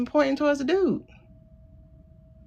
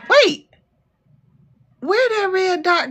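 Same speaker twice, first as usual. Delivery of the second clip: sharp high loud wait; sudden surprised wait; 9 semitones higher, 4 dB louder than usual. Real, not acted. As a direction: stop everything, something caught your eye. Sudden alarm at red dot.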